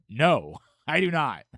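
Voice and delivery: loud, punched mock refusal; emphatic denial. Host answering fellow travelers about broadcaster voice, emphatic denial. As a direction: bat the question away loudly. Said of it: "And I said".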